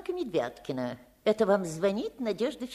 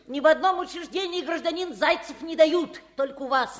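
indignant; 7 semitones higher, 5 dB louder than usual. Acted. Woman argues with appraiser.

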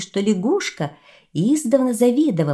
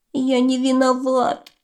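crying; 7 semitones higher than usual. Acted. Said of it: "заплакал кролик".

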